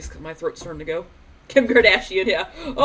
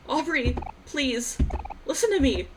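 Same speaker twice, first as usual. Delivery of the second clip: pleading; 9 semitones higher, slower than usual. Acted. Character pleads with friend.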